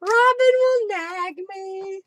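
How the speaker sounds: high, drawn-out playful taunt; slow, high-pitched teasing repeat; teasing singsong. Pretend. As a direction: stretch it out loudly in a high singsong. Repeats it teasingly after laughing.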